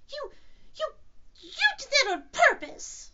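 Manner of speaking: halting start bursting into a high squeal; stammer, then accuse loudly and slowly; spluttering. Acted. Piglet with soap in his mouth, spluttering. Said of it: "spluttered Piglet".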